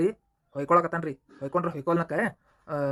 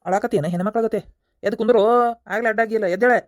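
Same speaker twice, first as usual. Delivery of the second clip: loud barked orders to get up; angry scolding; 3 semitones higher, 7 dB louder than usual. Acted. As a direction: bark at him to sit up. Constable scolds prisoner to get up.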